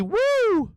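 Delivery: high whooping cheer; excited whoop. Real, not acted. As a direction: throw out a big happy whoop. Cheering a viewer's name.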